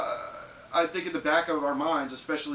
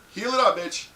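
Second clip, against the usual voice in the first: louder barked order, a touch higher; drill sergeant; 9 semitones higher, 7 dB louder than usual. Pretend. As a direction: command the ladies like a drill sergeant. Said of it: "Yes, drill sergeant!".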